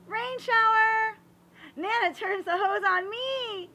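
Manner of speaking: put-on high, loud, delighted cry of a splashed kid; excited squeal. Child gets sprayed by Nana's hose, high delighted shriek. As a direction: squeal it like the cold water just hit you.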